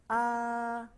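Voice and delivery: "A" held high, loud and flat; high level tone. Shown as a demonstration. Teacher modelling the first tone for students. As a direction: sing out a long high steady "A". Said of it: "Yuk ulangin sama-sama, perhatikan".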